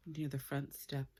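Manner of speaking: usual speaking voice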